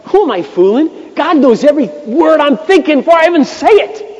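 acted-out rant at God, high and loud; hammed-up fed-up complaint to God; stewing outburst. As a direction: explode with pent-up frustration, rising to a shout. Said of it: "it was all stewing inside of me".

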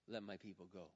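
low, soft demand to the king; hushed, solemn command; quiet command. Acted. Moses to Pharaoh, a soft low command.

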